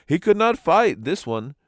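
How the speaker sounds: high, mocking hoot; a high, scornful hoot; hooting mockery. A narrator's reading. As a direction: jeer at the fallen one. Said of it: "hooted the others".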